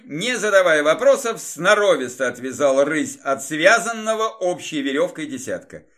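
ordinary delivery, nothing marked

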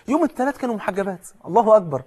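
high, loud burst of joy; joyful exclamation. Real, not acted. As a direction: cry out Allahu akbar in delight. Joyful about sisters taking hijab.

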